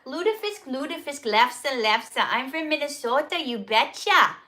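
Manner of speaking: hammed-up Minnesota accent with bouncing pitch; playful, overdone Minnesota lilt; exaggerated Minnesota accent. Shown as a demonstration. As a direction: lay on a thick Minnesota accent. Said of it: "I'm just gonna exaggerate it a little bit here".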